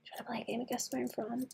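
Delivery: fast breathy whisper. Pretend. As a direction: whisper the offer quickly. Repeats offer in whisper.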